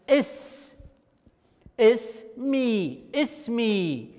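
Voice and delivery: اسمي split slowly into اس and مي; syllable demo. Shown as a demonstration. Teacher sounding out اسمي to pupils, slow syllables. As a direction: stretch اسمي syllable by syllable for the class. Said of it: "المقطع الأول ساكن اس".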